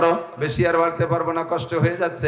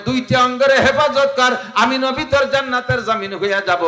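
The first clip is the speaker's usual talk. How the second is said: full-force preaching; 7 semitones higher than usual. Acted. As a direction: preach it out to the crowd, voice raised high. (উচ্চ স্বরে জোরালো ওয়াজ)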